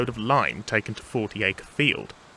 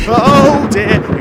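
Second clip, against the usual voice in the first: high, very loud yelp; alarmed exclamation; 13 semitones higher, 14 dB louder than usual. Acted. Thomas hearing the ghost at the door, alarmed. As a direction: burst out in sudden dismay. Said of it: "exclaimed Thomas".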